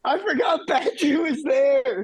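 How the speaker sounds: blurting out a forgotten fact, shouting; surprised shout. Real, not acted. Player suddenly remembering a character, surprised shout.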